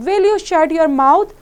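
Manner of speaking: loud, high, slow snap; sharp scold. Acted. Bedridden old woman snapping at the little girl, loud and sharp. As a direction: bark it out to silence her.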